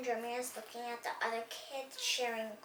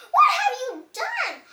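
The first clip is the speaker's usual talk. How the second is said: high, loud outburst, then slow; shocked yell; 5 semitones higher, 11 dB louder, slower than usual. Acted. Teacher shocked at shared clothes, high loud yell. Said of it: "The teacher yelled".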